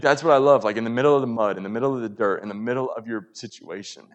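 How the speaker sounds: usual speaking voice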